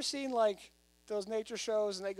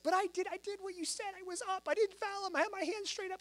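high-pitched imitation of an indignant boy; mock whiny young son, pitched way up; protesting kid; 12 semitones higher than usual. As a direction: plead your case like a wronged child. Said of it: "He's like".

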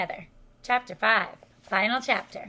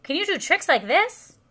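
higher, lilting boast; crying out; 5 semitones higher, 4 dB louder than usual. A narrator's reading. Toad boasts of his high wire tricks, crying out. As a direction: show off, pitched up. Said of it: "cried Toad".

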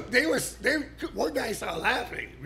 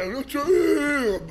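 mock whiny punk; 7 semitones higher than usual. Mocking a whiny punk kid.

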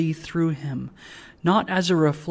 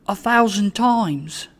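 higher-pitched earnest reply; humble, earnest Trotty; 5 semitones higher than usual. A narrator's reading. Trotty assures the bell of his thanks, earnest. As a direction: assure them, voice lifted.

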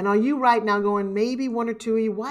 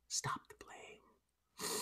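hushed, slow whisper; a slow whispered mantra to the audience; 23 dB quieter, much slower than usual. Acted. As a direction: breathe the words out slowly, barely voiced. Repeated even softer, slower.

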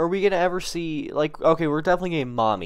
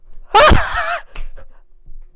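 overwhelmed laugh; 9 dB louder than usual. Real, not acted. Overwhelmed reaction.

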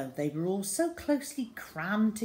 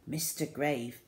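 plain reading voice

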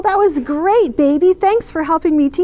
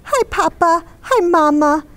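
story narration; 3 semitones lower than usual. A narrator's reading. Reads the picture book aloud.

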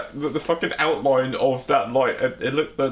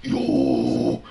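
deep growling yo, pitched way down; best growl; 13 semitones lower, 4 dB louder than usual. Pretend. Gamer showing off a death metal growl, deep growl. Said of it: "it's my best growl".

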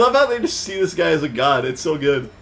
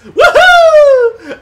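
very high, loud squeaky cheer; ewok cheer; 12 dB louder than usual. Pretend. Gamer voicing the Ewoks cheering their new god. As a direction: whoop like a tiny jubilant creature.

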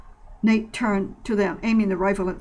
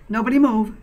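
louder, firm command; insistent; 3 semitones higher, 6 dB louder than usual. Acted. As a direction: hold everyone still with authority. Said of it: "Kevin insisted".

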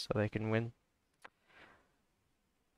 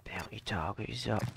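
unvoiced whisper of the game line; whispered echo; 3 semitones lower, 6 dB quieter than usual. Streamer whispering the announcer's line back. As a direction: breathe the callout back under your breath.

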